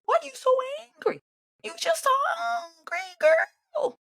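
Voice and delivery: very high, whining, slowed mock voice; hammed-up high crying mockery; mock whiny squeal. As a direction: squeal it out like a taunting whine. Mocking her angry tone.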